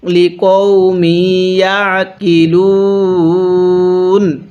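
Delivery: লিকওমি ইয়াকিলুন slow and chanted; tajweed recitation. Shown as a demonstration. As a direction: chant it slowly like Quran recitation. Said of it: "কিভাবে পড়তে হবে?". (ধীর তিলাওয়াতের সুরে)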